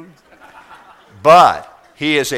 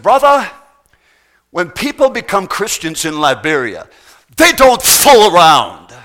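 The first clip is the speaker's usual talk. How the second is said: forceful preacher's answer; 3 semitones higher, 8 dB louder than usual. Retold or imitated. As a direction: boom it out like a fiery Liberian pastor settling the matter. Retelling the Liberian preacher's forceful answer.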